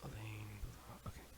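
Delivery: whispered practice line. Real, not acted. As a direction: breathe the words unvoiced. Practising line under breath.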